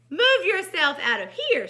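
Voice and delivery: loud, high command; forceful storybook snake; bossy big snake. A narrator's reading. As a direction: order the pig out. Snake orders pig away.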